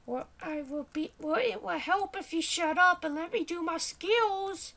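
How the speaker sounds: high-pitched cartoonish sidekick; testy little high voice; bickering sidekick voice. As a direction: pitch it high and tell the player off. Plays the character's sidekick voice.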